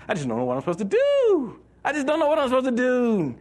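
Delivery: high, whiny lost-soul voice; whiny hippie. Retold or imitated. Preacher mocks directionless believers in a whiny high voice. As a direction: whine it like a drifting hippie. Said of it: "the hippies followed us".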